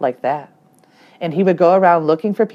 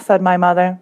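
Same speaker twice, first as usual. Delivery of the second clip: usual speaking voice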